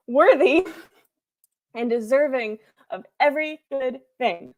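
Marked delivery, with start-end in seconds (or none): 0.1-0.7 loud, high burst on Worthy; emphatic. Real, not acted. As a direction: hit Worthy loud and high as you find the word.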